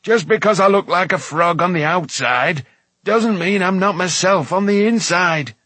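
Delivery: loud, high, indignant protest; irate dwarf insisting; snapping. A narrator's reading. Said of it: "snapped Dolly's voice".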